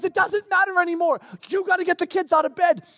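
high-pitched imitation of harried wife; mock frantic urgency. Wife ordering husband to wake kids, mock frantic.

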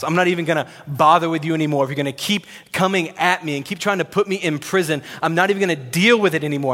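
exasperated and pushing, quick bursts; frustrated, done. Acted. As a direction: act out washing your hands of them. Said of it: "And he is frustrated".

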